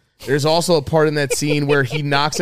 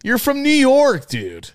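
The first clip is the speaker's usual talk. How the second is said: very high, loud outburst; exasperated shout; 11 semitones higher, 4 dB louder, slower than usual. Acted. Telling off upstaters acting like bikers, exasperated shout. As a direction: yell it in mock outrage. Mock outrage at upstaters' act.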